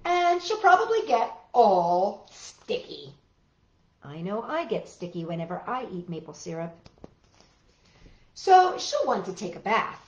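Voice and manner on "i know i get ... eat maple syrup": usual speaking voice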